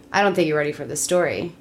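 usual speaking voice

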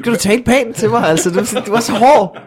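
high-pitched indignant comeback with a laugh; mock offended protest. Real, not acted. Protests at being called a crybaby. (spøgefuldt fornærmet, lys stemme)

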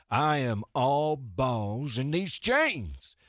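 slow, high hammed-up cowboy. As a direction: drawl it like a goofy cowboy. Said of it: "Cowboy DeHuff".

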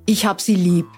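usual speaking voice

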